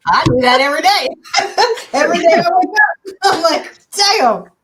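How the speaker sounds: laughing while talking. Real, not acted. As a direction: laugh through the words. Laughing at own joke.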